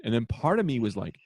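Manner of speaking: normal talking voice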